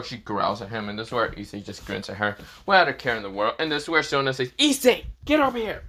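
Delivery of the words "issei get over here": high-pitched impatient calling out; summoning call. Acted. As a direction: call someone over sharply, much higher. Sona calls Issei over in story.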